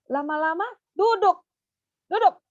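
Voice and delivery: teacher commanding a pupil; firm command. Shown as a demonstration. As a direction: tell the child firmly to sit. Demo commanding child to sit.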